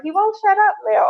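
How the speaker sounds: way up high, laughing parent quote; a parent gushing that he won't stop; high amused parent voice. Retold or imitated. Therapist mimicking a delighted parent, high voice.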